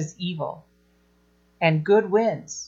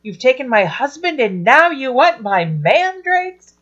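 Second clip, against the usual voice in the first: higher, mock-outraged retort; a light hammed-up scandalised sister; indignant Leah; 7 semitones higher, 3 dB louder than usual. Leah snapping back at Rachel, mock indignant. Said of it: "Leah's response is".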